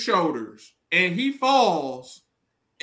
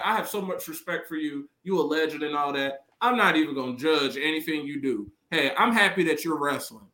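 lightly voiced respectful [name]; 8 semitones lower than usual. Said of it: "[name] is like".

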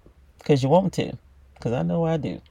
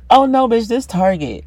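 sassy reply; 5 semitones higher, 6 dB louder than usual. Acted. Acted proud reply to admirer.

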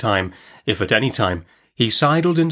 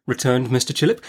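ordinary delivery, nothing marked

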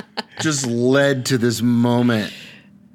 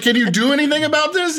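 much higher and louder, pleading; exasperated plea; 6 dB louder, faster than usual. Retold or imitated. Retelling the tire shop visit, high exasperated plea. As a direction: beg the tire guy in disbelief. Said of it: "I'm like".

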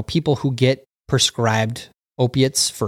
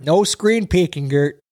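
higher, slower teasing warning; mock scolding; 6 semitones higher, slower than usual. Host echoes the warning to Gert, slow and teasing. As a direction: wag a finger at the chatter, playfully.

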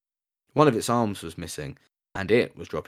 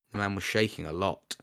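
ordinary delivery

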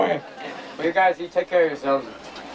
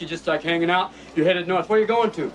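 ordinary talk, nothing marked

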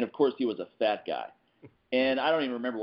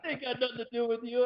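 high mock threat; movie tough-guy line; 13 semitones higher than usual. The line he wished he'd used on the husband, mock menace. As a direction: deliver it like a cool hitman. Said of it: "use that line".

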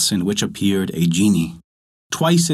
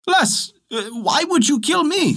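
much higher, panicked protest; a scared little man pleading, pitched way up; frightened fisherman crying out. Acted. Fisherman begs the genie who threatens him, high and frightened. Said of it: "cried the fisherman".

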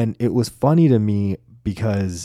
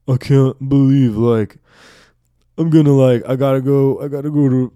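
put-on louder, slow, teary drunk father; a touch of drunk tearful dad; 4 semitones higher, 3 dB louder than usual. Drunk dad tells his son he'll miss his birthday, tearful. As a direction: plead like a sobbing drunk dad.

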